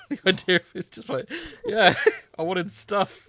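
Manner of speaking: giggling through the words; laughing. Real, not acted. Mocking the review's complaint while laughing. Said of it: "it has really tickled me".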